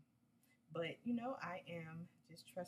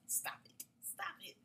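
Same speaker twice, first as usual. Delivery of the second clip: giggly high stop it, twice; laughing at herself; 14 semitones higher, slower than usual. Real, not acted. Vlogger laughing at her own New York claim, giggling. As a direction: shoo away your own joke through laughter. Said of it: "scolding herself, laughing".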